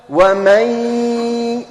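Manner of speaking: normal talking voice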